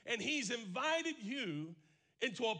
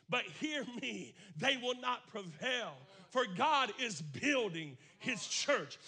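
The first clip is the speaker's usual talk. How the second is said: loud, slowed preaching climb; 3 semitones higher, 5 dB louder than usual. Acted. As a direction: push it out, louder. Preaching rising again.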